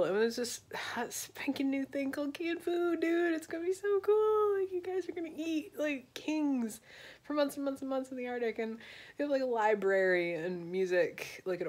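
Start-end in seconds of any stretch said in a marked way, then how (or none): 0.0-8.6 much higher excited bro voice; giddy high-pitched sales pitch; mock hype. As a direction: squeal it like a salesman. Hyping canned food as Admiralty.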